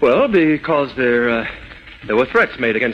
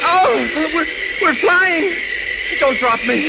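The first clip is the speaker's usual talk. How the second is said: high-pitched stammering fright; terrified; 13 semitones higher, slower than usual. Acted. Doctor carried through the sky by Superman, terrified. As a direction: gasp it out high, scared of falling.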